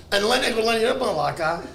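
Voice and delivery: ordinary talk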